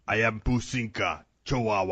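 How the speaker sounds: tough little dog voice, low; barbarian. Pretend. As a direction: growl it like a tiny brute.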